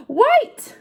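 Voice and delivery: excited reveal. Acted. Answering loudly.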